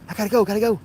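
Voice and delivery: high, squeaky mock voice of a rushing grandma; mock frantic driver. Driver mocks the speeding grandma behind the wheel, squeaky and frantic. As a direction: squeak it out like she's late for everything.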